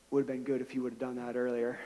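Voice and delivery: quiet, quick, dry aside; dry deadpan quip. Retold or imitated. Injured man wryly telling the pastor, quiet dry deadpan. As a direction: toss it off wryly under your breath. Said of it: "he looks over at me and he says".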